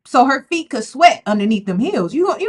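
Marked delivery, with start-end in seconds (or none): none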